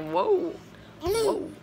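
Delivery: high, soft stunned whoa; half-joking amazement; mock awe. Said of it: "I was like".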